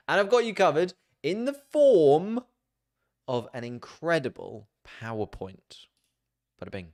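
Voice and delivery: big excited announcement; hyped-up promise. Acted. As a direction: sell it like a game show host.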